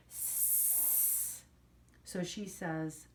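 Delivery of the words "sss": unvoiced hissing sound like a radiator. Shown as a demonstration. Teacher imitating a radiator hiss for the class. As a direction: hiss softly like a heating pipe. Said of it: "it sounds like this".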